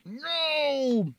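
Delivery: high drawn-out no; dismayed cry. Real, not acted. Sauce lands on his pants.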